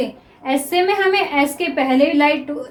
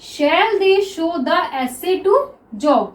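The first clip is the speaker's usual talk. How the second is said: very slow and even; slow dictation reading; much slower than usual. Shown as a demonstration. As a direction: dictate each word for the class. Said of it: "next देखिए".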